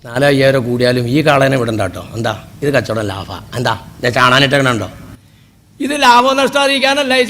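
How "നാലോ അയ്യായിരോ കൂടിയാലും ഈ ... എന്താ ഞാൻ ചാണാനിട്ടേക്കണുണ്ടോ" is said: lower, sly dealer's sales pitch; cattle broker. Retold or imitated. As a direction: talk the buyer into the deal, pitched well down. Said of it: "കാളയാണെങ്കിൽ ചാണാനിട്ടാ പറയും".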